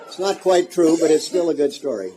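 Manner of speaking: laughing. Real, not acted. Teasing about his story.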